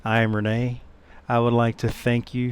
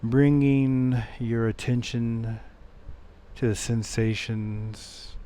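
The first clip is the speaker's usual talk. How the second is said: soft, unhurried meditation voice with long pauses; guided meditation; 4 semitones lower, 13 dB quieter than usual. Acted. Meditation teacher leading listeners back to the breath, soft guided-meditation voice. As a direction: guide them gently, much softer than a welcome. Leads a meditation after intro.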